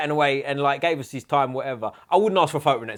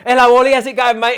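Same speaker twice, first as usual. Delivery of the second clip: much higher, louder matey hello; chirpy overfamiliar bro greeting; cheery gym greeting; 11 semitones higher, 11 dB louder than usual. Retold or imitated. Gym stranger greeting Ollie, high and loud. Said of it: "he goes".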